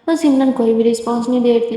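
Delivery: ordinary delivery, nothing marked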